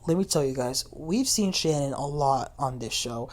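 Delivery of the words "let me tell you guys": usual speaking voice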